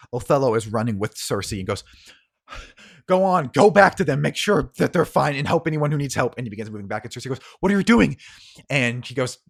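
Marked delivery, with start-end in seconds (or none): 3.1-6.3 high, loud, rushing command; urgent orders. Acted. Othello sends Cersei back to help, urgent. 7.6-8.2 high, loud, incredulous demand; alarmed shout. Acted. Cersei sees Othello turn back, alarmed.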